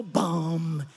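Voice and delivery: long held "bum"; imitated band hit. Preacher playing the church band, held instrument hit. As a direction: boom out the band's beat. Mimicking the band between sung lines.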